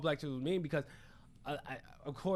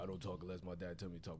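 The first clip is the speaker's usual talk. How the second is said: obedient little soldier; 8 semitones lower, 11 dB quieter, faster than usual. Acted. Plays little son as soldier.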